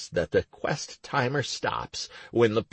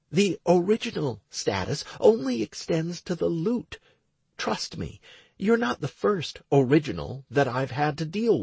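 lightly raised woman's voice; young woman voice; 8 semitones higher than usual. Acted. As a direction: voice Stacy a little higher. Stacy continues.

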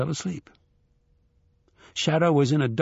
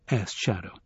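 plain reading voice

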